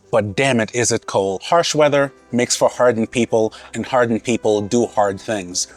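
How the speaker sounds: ordinary talk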